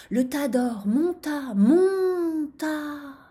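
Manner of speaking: rising, drawn-out narration; climbing voice. A narrator's reading. Narrator shows gold piling up, climbing voice. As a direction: stretch and lift the voice as the pile grows.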